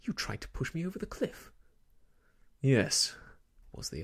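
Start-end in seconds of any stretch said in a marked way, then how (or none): 0.0-1.5 high, rushed, gasping accusation; pitched-up, breathless alarm; gasped. A narrator's reading. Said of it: "he gasped". 2.6-3.2 loud, explosive admission; sudden forceful outburst; defiant shout. A narrator's reading. Villain's defiant answer in acted quarrel.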